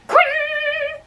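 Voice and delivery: a very high, ringing "Quing" sword sound; a reader voicing the clash of blades; mouth sword clang. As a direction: ring it out like steel on steel. Imitated sword sound in fight.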